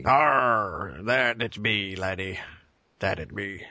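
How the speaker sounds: gruff pirate growl; lightly hammed-up pirate talk. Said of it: "International Talk Like a Pirate Day".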